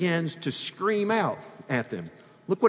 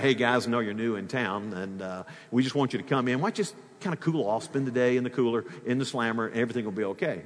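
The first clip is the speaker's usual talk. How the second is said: softer, easygoing sarcastic guard; ironic, softer friendly-guard voice; mock friendly jailer; 5 semitones lower, 4 dB quieter, faster than usual. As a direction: play the jailer as falsely kind.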